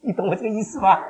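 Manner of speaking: ordinary talk, nothing marked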